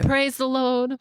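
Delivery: playful Southern church exclamation; Southern accent. Pretend. Joking about sweet Southern talk, playful Southern accent. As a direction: toss it off in a put-on Southern accent. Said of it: "Southern accents".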